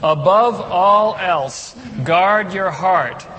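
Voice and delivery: slow, loud, every word spelled out together; slow group recitation. Shown as a demonstration. Pastor leading the church in reciting the verse, slow and loud. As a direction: lead the congregation through the verse slowly. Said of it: "Read it with me".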